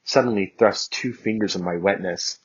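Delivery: usual speaking voice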